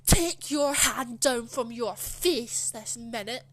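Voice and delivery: slow, loud mock shout; retold scream. Teacher to pupil leaning on hand, screamed order. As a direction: imitate a teacher shouting each word slowly. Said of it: "and he screamed at me".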